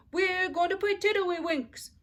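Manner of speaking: high, slow singsong insistence; bossy child. A narrator's reading. Ramona insisting to Beezus while mother talks on the phone. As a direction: tease it like a pesky little sister. Ramona pestering her sister.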